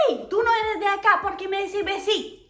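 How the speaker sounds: voz ajena y más aguda, de reproche; vendedora que rechaza. Pretend. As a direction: imagina a una vendedora desconfiada que la pilla. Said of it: "que me diga". (vendedora imaginada, aguda y recelosa)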